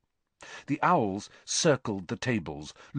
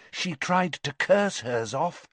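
ordinary delivery

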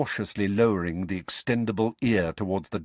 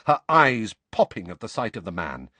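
plain reading voice

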